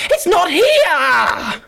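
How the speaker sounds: high, slow, wailing cry; anguished cry. Acted. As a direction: cry it out in despair. Acted despair in a scene.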